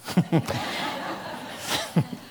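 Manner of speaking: quiet airy chuckle; soft breathy laugh. Real, not acted. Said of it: "laugh after question".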